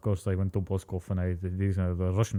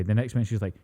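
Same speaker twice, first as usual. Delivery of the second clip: ordinary talk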